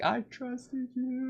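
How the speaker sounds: high, slow, wounded plea; quiet heartbroken cry of betrayal; betrayed hero. Retold or imitated. Peter to his mentor turned villain, wounded and betrayed.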